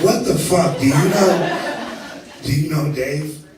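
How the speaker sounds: hammed-up laughing outrage; laughing disbelief. Incredulous outburst, laughing.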